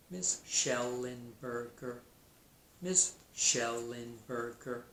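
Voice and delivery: "Schellenberger" slowly in four even beats; slow, measured teacher demonstration of "Schellenberger"; syllable by syllable. Shown as a demonstration. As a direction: sound out each syllable of "Schellenberger" for counting. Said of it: "how many syllables you think you hear".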